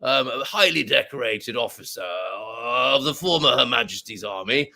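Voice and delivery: put-on plummy old army officer reminiscing; Colonel Bradbury. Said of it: "It's just what I heard in my head".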